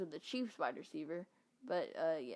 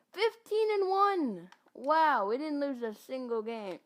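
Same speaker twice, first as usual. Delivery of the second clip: amazed; 7 semitones higher, 7 dB louder than usual. Real, not acted. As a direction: stretch "Fifteen and one" out in delight. Amazed at perfect season.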